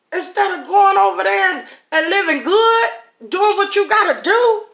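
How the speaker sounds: can you believe these people; incredulous. Real, not acted. Preacher exasperated at the complainers, high disbelief.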